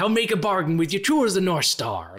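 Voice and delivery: lightly hammed pirate brogue. Pirate Steve's Dodgeball line, mock pirate brogue. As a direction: promise it like a swaggering pirate. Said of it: "it's a little bit that".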